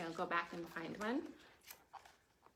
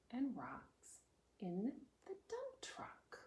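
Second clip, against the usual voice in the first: whisper; 4 semitones higher, 5 dB quieter, slower than usual. Acted. Whispered reading.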